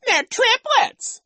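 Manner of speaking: squealed, slow falsetto echo; shrieking childish imitation; mocking mimicry. A narrator's reading. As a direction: parrot her in a very high, loud jeer. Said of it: "Nero mocked".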